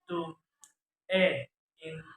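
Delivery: normal talking voice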